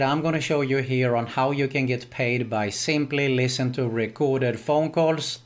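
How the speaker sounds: ordinary talk, nothing marked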